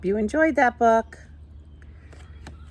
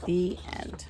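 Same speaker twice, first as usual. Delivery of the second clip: lively, sing-song storybook reading, higher than her chat; reading to children; 5 semitones higher than usual. Acted. Teacher reading a picture book to her class, animated storytelling. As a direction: read it like a picture book for little ones.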